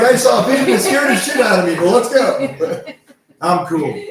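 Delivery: high, loud laughing mock bravado; mocking the player's fake calm; teasing laughing quote. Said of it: "You're like".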